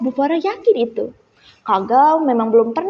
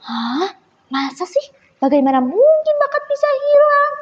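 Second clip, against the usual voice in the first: incredulous friend; 11 semitones higher than usual. A narrator's reading. A friend questions how talent could vanish, incredulous. Friend reacts in disbelief to news.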